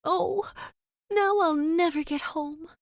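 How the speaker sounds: Dorothy left behind, tearful; crying. Acted. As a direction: say it close to tears. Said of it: "began to cry".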